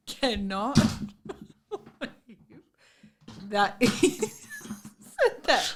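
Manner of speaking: laughing through the words; words broken by laughter. Real, not acted.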